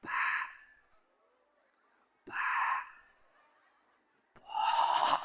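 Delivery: very soft, breathy whisper; gentle whispered call. Acted. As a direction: whisper to wake a sleeping passenger. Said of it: "ngomongin lemah lembut". (bisikan lembut pramugari)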